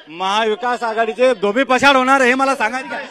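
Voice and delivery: high, forceful rally cry; rousing declaration. Real, not acted. Party supporter predicting the rival alliance's defeat, rousing. Rousing boast at political debate.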